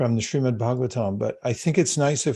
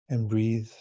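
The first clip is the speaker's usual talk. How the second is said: guided meditation. Acted. Yoga teacher guiding the class into calm meditation. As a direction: ease the group into stillness.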